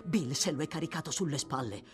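fast, urgent tumble of words; agitated account. Acted. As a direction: race through it, climbing in pitch towards the end. Toby recounts fleeing with wounded boy.

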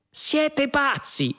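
very high, drawn-out cry; yell. A narrator's reading. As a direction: cry out slowly at a high pitch, appalled. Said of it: "urlò Trillian".

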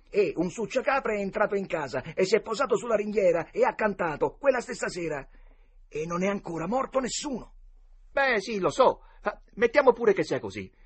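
put-on high, loud, animated boyish voices; excited boys arguing. A narrator's reading. Boys debate omens of death, excited and insistent.

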